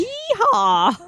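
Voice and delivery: high cowboy whoop; cowboy yeehaw. Pretend. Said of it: "getting the right accent for this one".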